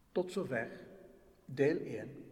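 ordinary delivery

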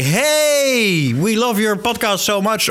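high, eager fan voice; mock gushing fan. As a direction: gush like a delighted listener. Example listener voice notes.

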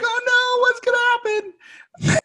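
high, slow whiny wail; squeaky mock-distressed voice; mock crying girl. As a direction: ham up her tearful panic. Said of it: "already crying".

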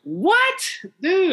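high, startled what; shocked. Real, not acted. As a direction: blurt it out in disbelief. Reacts to "most ignorant place.

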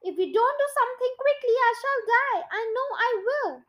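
high, hurried panic; mild acted scream. A narrator's reading. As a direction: rush it out high in storybook alarm. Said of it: "screamed Missus Twit".

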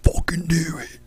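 frat bro warning in a whisper; whispered frat guy. Retold or imitated. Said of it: "my frat guy character came back".

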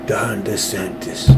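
soft, slow New York Italian mobster voice; hushed, unhurried go at a New York mafia don. Shown as a demonstration. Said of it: "that's a little bit better".

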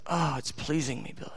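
hushed delight, lightly voiced; voicing God. Preacher voicing God pleased with Billy, soft and tender. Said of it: "He goes".

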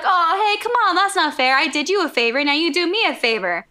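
high, hammed-up objection; whining protest. Bad guy wanting his favor returned, protesting. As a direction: start high and wheedle for the favour.

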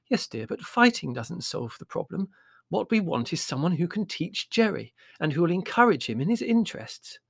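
high-pitched, patient motherly voice; Mother, placating. Acted.

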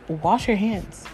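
ordinary delivery

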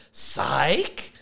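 usual speaking voice

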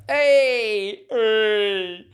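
loud, high "Hey!" called out twice; cheerful hey. Real, not acted. Calling out to a chat joke, loud and high. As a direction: greet the comment with a loud cheer.

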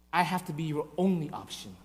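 low, soft and grave; hushed divine reply; quiet insistence. Acted. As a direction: state it quietly, as a solemn condition. God's reply, first said quietly.

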